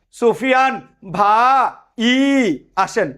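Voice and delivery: ভাই broken into slow, stretched ভা and ই in the greeting; wrong vowel split. Shown as a demonstration. Teacher mock-greeting Sufian bhai on the street, ভাই wrongly split into ভা, ই. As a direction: call out with ভা and ই as two long separate beats. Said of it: "এরকম বলবা?".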